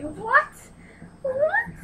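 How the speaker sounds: high, loud, rising whats; shocked. Retold or imitated. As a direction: re-enact a startled what. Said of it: "that even made my heart pound".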